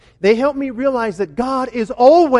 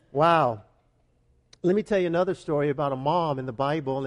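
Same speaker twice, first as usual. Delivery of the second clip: ordinary talk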